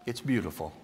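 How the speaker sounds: beautiful said low and soft, falling gently; mean every word of beautiful, hushed; sincere praise. Shown as a demonstration. The psalm's mourning praised, low and tender. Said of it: "But in Psalm fifty-one".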